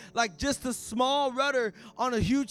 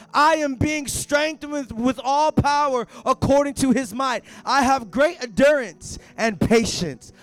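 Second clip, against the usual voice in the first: slow, louder proclaiming; faith declaration; 3 semitones higher, 10 dB louder than usual. Shown as a demonstration. Preacher models a bold declaration for the congregation. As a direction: declare each word like a promise. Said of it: "that's a faith declaration".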